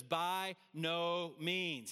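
"By no means" stretched out slowly, each word weighted; emphatic refusal. Acted. Preacher quoting Paul's answer with an exclamation point, slow emphatic "By no means". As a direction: spell out "By no means" slowly and firmly. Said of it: "he emphatically here, with an exclamation point".

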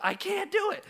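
put-on very high panicked kid voice; urgent child. Dad imitates son surfacing a third time, urgent child. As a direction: squeal it like a child going under. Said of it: "more urgency in his voice".

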